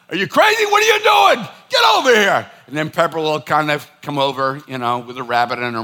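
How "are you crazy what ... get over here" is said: much higher, much louder exasperated yell at the dog; scolding shout. Retold or imitated. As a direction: bellow at the misbehaving dog. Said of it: "I'll be like".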